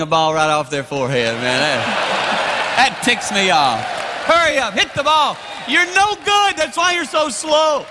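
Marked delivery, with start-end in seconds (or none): 4.3-7.9 impatient mock heckling. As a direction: holler in exasperation, stretching the last words. Acting out heckling slow golfers.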